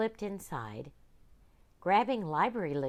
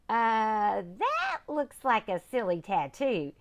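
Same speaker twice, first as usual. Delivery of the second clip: put-on giggling girl; 5 semitones higher, 6 dB louder, slower than usual. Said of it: "giggled a girl".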